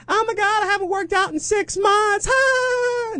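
very high squealed whine; high-pitched mock scream, no extra volume; mock screaming partygoers. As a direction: imitate douchey LA partiers shrieking. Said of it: "it's just people screaming outside".

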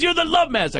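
high, mocking take on Love Master; mocking psychic. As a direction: sneer it like a teasing rival. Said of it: "He goes".

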